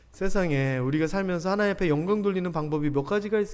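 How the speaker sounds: normal talking voice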